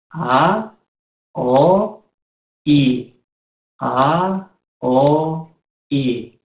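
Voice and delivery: "A, O, I" each said alone, separated by pauses; vowel demonstration. Shown as a demonstration. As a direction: recite "A, O, I" one vowel at a time, like a teacher. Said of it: "nuestras tres primeras vocales son". (demostrar "A, O, I" vocal por vocal, con pausas)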